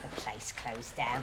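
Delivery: low, soft, slow repeat; sceptical echo. Acted. Wife repeating husband's excuse for losing work, sceptical.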